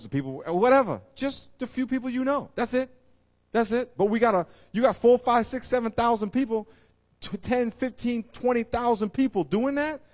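high, loud revival preaching; preaching at full force. Real, not acted. Preacher urging the church to think of their world, full force. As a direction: drive it home to the congregation.

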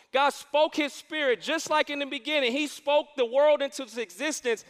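ordinary delivery

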